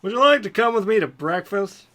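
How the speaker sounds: a touch of eager courtesy; polite query. A narrator's reading. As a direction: invite a new friend politely in character. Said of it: "Ronald queried politely".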